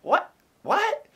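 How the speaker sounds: rising incredulous whats; stunned disbelief. Retold or imitated. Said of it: "I'm like".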